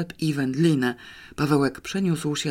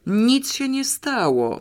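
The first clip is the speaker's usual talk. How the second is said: higher, drawn-out impatient reply; 9 semitones higher, slower than usual. A narrator's reading. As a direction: brush it off with mild irritation. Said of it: "powiedział niecierpliwie". (zniecierpliwiony, wyższy ton)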